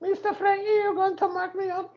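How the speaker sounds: high falsetto maid, played gently; mock housekeeper voice. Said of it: "I'll be like this".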